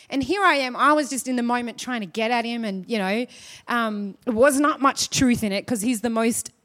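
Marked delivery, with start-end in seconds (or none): none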